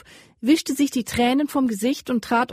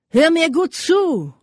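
slow, higher and louder warning from the rider; menacing leader; 8 semitones higher, 6 dB louder, slower than usual. A narrator's reading. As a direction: lean in and threaten the prisoner. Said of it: "begann der Berittene".

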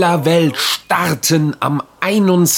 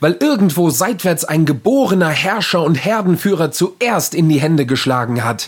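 story narration; 5 semitones higher than usual. Acted. Literary reading.

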